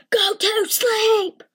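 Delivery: loud slow angry shout. Acted. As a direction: bark it at the kids, drawn out. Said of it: "they both shouted".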